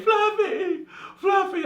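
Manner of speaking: high, wailing name through mock tears; grieving owners on the phone, high hammed-up crying. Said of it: "I hear all this crying".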